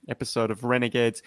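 usual speaking voice

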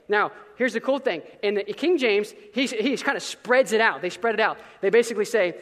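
ordinary talk

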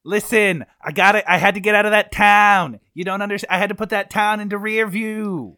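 a lightly put-on Irish-Boston accent; a suspect clinging to a put-on accent. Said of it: "drop this whole accent thing".

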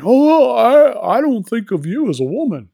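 high, loud, singsong backtracking; hammed-up high-voiced protest; mock flustered captain.

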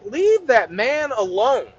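very slow "Leave that man alone"; slow, solemn plea. Acted. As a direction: draw out each word in protective sympathy. Sympathy after the man cried.

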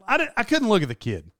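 ordinary talk, nothing marked

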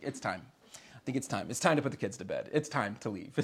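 low, soft mock of his wife saying it's time; imitating Amanda. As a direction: gently mimic her hint that it's time to go. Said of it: "as Amanda would say".

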